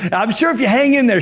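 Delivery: high, cheery pep talk; John Spanath. Retold or imitated. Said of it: "he's giving me a motivational speech".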